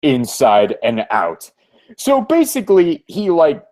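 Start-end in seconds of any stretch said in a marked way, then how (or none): 0.0-1.5 low, deliberate gloating monologue; scheming comic-book villain. Acted. Villain addressing Aidan Blaine whom he has studied, low and smug. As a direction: savour it like a self-satisfied supervillain.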